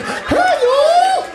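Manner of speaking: very high southern holler; high-pitched comic southern drawl, loud on the last word; southern accent. Acted. Ventriloquized puppet calling out, loud high southern accent. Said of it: "It talks in a southern accent".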